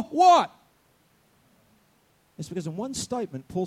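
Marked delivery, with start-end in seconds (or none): 0.0-0.5 loud prompt. Real, not acted. Prompting congregation to answer.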